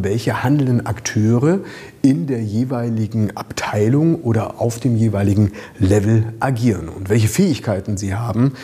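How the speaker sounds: ordinary talk, nothing marked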